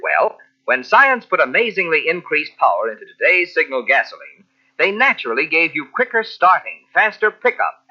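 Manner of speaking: usual speaking voice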